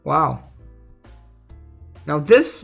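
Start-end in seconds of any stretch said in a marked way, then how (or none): none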